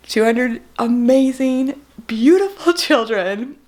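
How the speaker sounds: slow, real emotion welling up; close to crying. Real, not acted. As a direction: hold back tears, word by word. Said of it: "get through this without crying".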